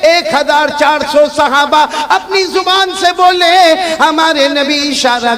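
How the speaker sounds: loud, slow chanted preaching; preaching at full force. Acted. Preacher to the gathering, loud chanted preaching. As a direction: lead the crowd in a rousing refrain.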